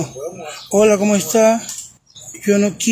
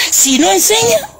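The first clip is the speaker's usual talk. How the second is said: mock crying whine; 9 semitones higher, slower than usual. Mocking whine.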